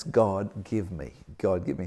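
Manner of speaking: quiet repeated begging of God; hushed plea. Retold or imitated. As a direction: murmur it like a needy prayer. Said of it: "And it's".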